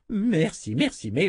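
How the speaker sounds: lightly voiced, high-pitched old man; thanks tossed off in a hint of elder's voice; impatient old wizard. Old wizard brushes off the welcome. (vieux sorcier un peu impatient)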